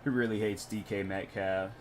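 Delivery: ordinary talk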